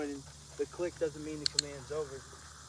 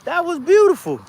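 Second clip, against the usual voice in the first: much higher and far louder, nearly yelling; ecstatic shout; 22 dB louder, slower than usual. Real, not acted. Cheering the dog's backflip again, a full ecstatic shout. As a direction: let it all out, even bigger. Dog does a flip, trainer thrilled.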